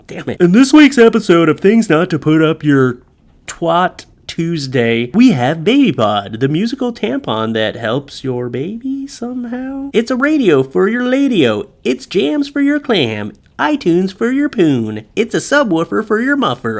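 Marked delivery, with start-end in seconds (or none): 0.4-4.0 loud, higher announcer intro; hyped show intro. Shown as a demonstration. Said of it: "let me do this again". 4.3-16.8 higher, punchy infomercial rhymes; hammed-up host. Cheesy show host pitch.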